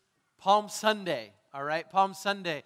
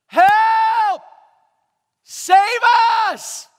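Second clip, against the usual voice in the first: screamed "Help! Save us!" much louder and higher; desperate cry; 14 semitones higher, 16 dB louder, much slower than usual. Acted. People begging for rescue, screaming for help. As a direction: cry out like someone drowning. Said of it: "Hosanna meant".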